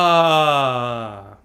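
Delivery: long, loud open sigh on ah; relaxed sigh. Shown as a demonstration. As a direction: let the breath fall out freely on a sigh. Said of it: "Two more".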